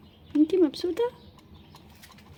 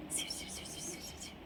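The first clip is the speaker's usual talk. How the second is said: breathy whisper, repeating seeb to the bird; hushed whisper coaxing the little bird; whispered calling; 21 dB quieter than usual. Real, not acted. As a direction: whisper it so only the bird hears.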